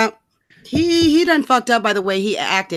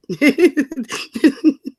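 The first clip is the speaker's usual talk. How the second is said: laughing; 6 semitones higher, 3 dB louder than usual. Real, not acted. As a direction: burst of laughter. Laughs at the speech.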